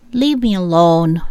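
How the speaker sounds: ordinary delivery, nothing marked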